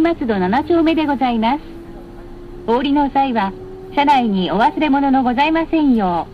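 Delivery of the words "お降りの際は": ordinary talk, nothing marked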